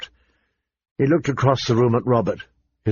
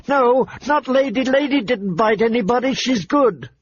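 put-on higher girlish voice, insisting; a young girl pleading her wolf's innocence; girl's desperate denial; 12 semitones higher than usual. A narrator's reading. Girl defends her wolf.

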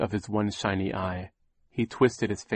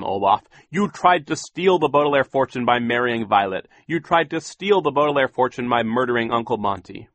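louder, stern accusing banker; Mister Poe voice; 5 semitones higher, 7 dB louder than usual. A narrator's reading. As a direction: official pronouncing judgment. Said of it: "Mister Poe said".

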